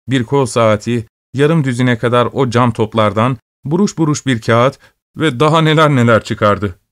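usual speaking voice

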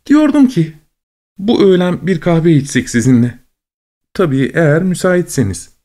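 high, bubbly invitation; chirpy high voice. A narrator's reading. Cheerful woman invites.

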